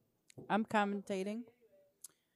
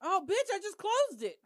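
panicked shout; 11 semitones higher than usual. Real, not acted. Panicked shout at closed app.

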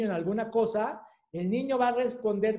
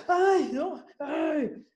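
put-on startled child; 8 semitones higher, much slower than usual.

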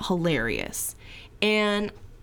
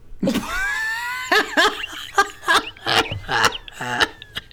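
high, loud helpless shriek of laughter; can't stop laughing, squealing; 3 dB louder than usual. Real, not acted. Laughing fit at a husband's joke, shrieking.

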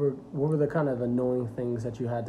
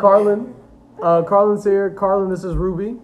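trying not to laugh; 5 semitones higher, 7 dB louder than usual. Real, not acted. Laughing through introductions.

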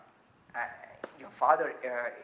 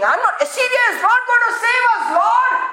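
higher, fast, fervent prayer; declaring to the Lord; bold declaration; 10 semitones higher, 3 dB louder than usual. Shown as a demonstration. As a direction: proclaim it to the Lord with conviction. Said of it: "declare right away".

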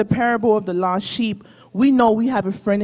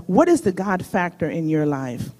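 usual speaking voice

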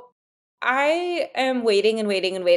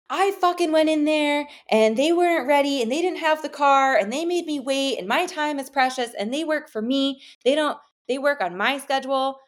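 mock entitled boyfriend; 6 semitones higher than usual. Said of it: "he was like".

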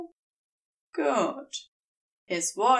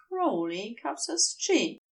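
ordinary delivery, nothing marked

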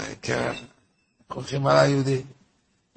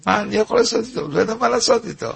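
exasperated quote; 7 semitones higher, faster than usual. Retold or imitated. Rabbi quoted, at a loss.

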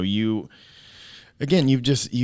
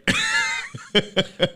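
big laugh. Real, not acted. Laughing after story.

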